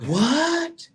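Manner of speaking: high squeaked shocked what. Retold or imitated. Jamie shocked on air squeaks in disbelief.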